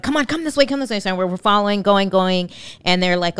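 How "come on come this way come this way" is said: urgent calling. Retold or imitated.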